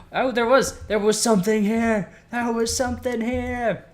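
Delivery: loud, high-pitched excited yell after a gasp; excited discovery. Real, not acted. Gamer finds something where he expected nothing, high excited shouting.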